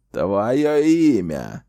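slow, loud menacing demand; sinister hiss. Acted. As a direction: drag out the name, slowly and loudly. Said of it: "зловеще прошипел он".

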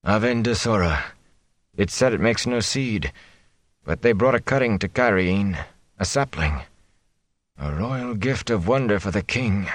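delirious father, half-murmured, far away; feverish murmuring. A narrator's reading. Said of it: "Tam spoke up again".

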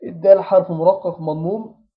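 ordinary talk

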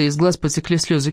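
plain reading voice

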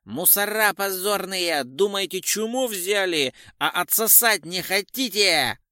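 hysterical yell. A narrator's reading. Bandit yelling at the cops from the dark, hysterical. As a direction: scream abuse at the police, out of control. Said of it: "истерический голос заорал".

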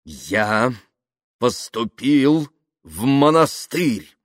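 slow, paused, emphatic announcement; slow and distinct. A narrator's reading. Kaftanov revealing his monastery life to Yuklin, slow and distinct. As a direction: set each word apart clearly. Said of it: "медленно и отчётливо произнёс Кафтанов".

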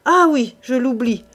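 loud, sharp angry comeback; violent retort. A narrator's reading. Pierre retorting to his wife, violent anger. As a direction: snap back at her. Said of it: "reprit-il avec plus de violence".

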